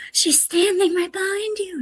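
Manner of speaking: put-on high, eerie little-girl voice for the possessed child; creepy possessed girl. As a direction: lift it up high and make it spooky, like a haunted child. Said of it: "she points and she goes".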